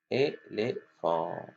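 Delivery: éléphant slow, in three separate beats; split into syllables. Shown as a demonstration. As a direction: break éléphant into é, lé, phant. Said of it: "découper". (« éléphant » découpé lentement en syllabes)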